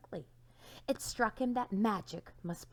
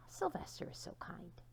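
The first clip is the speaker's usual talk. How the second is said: whispered aside; 11 dB quieter than usual. Acted. Self-praise whispered.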